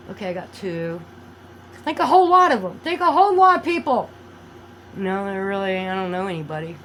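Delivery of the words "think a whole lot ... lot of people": high, loud, heated emphasis; half-joking rant, pitched up and loud; loud emphatic outburst. Real, not acted. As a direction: raise your voice and drive it home. Ranting at doubters, then says kidding.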